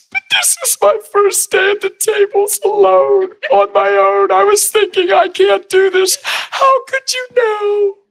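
high, tear-choked voice breaking into a crying shout; imitating a young single mom overcome with tears; tearful waitress. Single mom on her first day, crying high and slow. Waitress sobbing over the tip.

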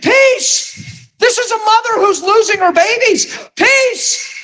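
very high-pitched, incredulous outburst; retold screaming. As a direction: re-enact screaming at her in disbelief. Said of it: "I'm screaming".

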